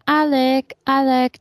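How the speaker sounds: mock foreign-accented Alec; imitated foreign accent. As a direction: put on her fake Spanish accent. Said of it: "to her voice".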